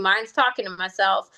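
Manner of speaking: normal talking voice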